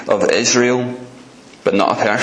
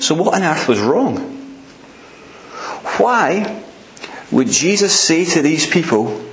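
higher-pitched, impassioned sermon voice; fervent preaching; 6 semitones higher than usual. Real, not acted. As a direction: press the questions home with raised pitch. Preacher builds rhetorical questions with fervour.

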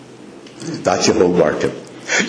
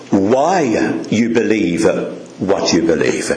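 normal talking voice

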